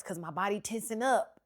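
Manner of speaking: ordinary talk, nothing marked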